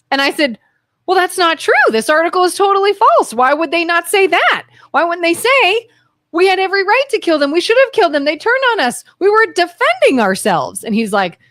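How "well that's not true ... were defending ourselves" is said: retold outrage, pitched well up; worked-up objection at higher pitch; indignant protest. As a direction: argue it back in high, incredulous disbelief. Retold protest at false article.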